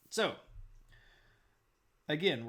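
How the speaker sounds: ordinary delivery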